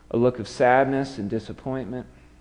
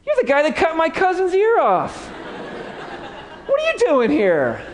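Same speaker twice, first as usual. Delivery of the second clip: indignant relative. Acted. Servant's cousin confronting Peter, high incredulous voice. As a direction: squeal it in disbelief with a laugh. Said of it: "He's like".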